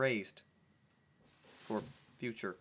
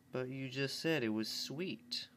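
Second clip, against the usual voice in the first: slow, doubtful filly voice; suspicious; 4 dB quieter than usual. Acted. Scootaloo doubts Dash's excuse, slow and suspicious. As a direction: narrow it down, unconvinced. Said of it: "donned a look of suspicion".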